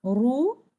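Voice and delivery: RU thin, tongue back down, rising tone; unelevated light ru. Shown as a demonstration. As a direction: demo 'ru' light, without lifting the tongue back. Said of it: "one time without elevating my back of the tongue".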